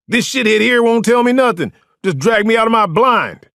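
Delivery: high, loud outraged rant; spitting mad. A narrator's reading. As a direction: shout your complaint in fury. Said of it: "He was in cuffs and spitting mad".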